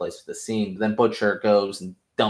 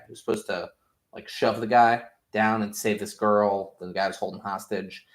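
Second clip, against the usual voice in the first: normal talking voice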